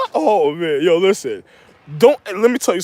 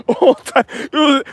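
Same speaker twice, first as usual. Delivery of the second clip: normal talking voice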